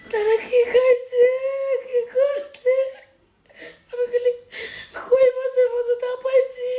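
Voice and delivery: real sobbing between phrases; crying. Real, not acted. Grieving to viewers about the helpless family, weeping. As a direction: wail it through tears.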